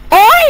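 sudden high loud ouch; sharp pained yelp. Acted. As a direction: cry out as if hit on the head. Struck, cries out.

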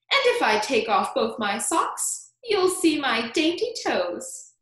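high, loud, self-admiring verse; Aunt Sponge declaring. A narrator's reading. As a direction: declaim each line like a vain beauty. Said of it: "Aunt Sponge declared".

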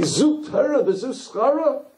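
high, slow, incredulous protest; angels' outraged question. Acted. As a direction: cry out to heaven in disbelief. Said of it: "And they say".

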